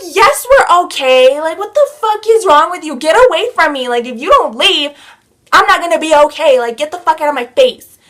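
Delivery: loud, high furious retort; so mad. Retold or imitated. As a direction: lash out to drive someone away. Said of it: "I'm so mad".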